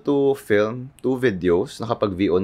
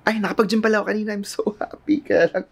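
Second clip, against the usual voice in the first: excited; 10 semitones higher than usual. Real, not acted. Cheerful vlog sign-off.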